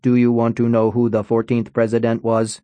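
flat, even robot voice; robot monotone voice. Acted. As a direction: speak like a stiff toy robot. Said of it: "In a monotone voice".

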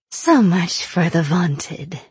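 slow, breathless wheeze fading to a whisper; wheezing. Acted. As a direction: gloat through ragged breath. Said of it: "Aina wheezed".

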